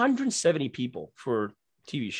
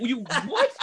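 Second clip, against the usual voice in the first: laughing mock surprise; 10 semitones higher than usual. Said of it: "I'm like".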